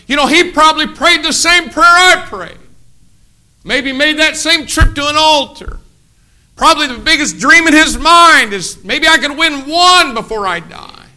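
high, loud climax of a sermon; preaching at full force to the congregation. Acted. Preacher lifts the crowd with a loud, high climax.

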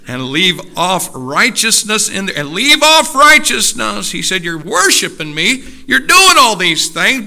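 loud, high, rising pulpit cry; thundering preacher; forceful preaching. Real, not acted. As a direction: build to a shout at the congregation.

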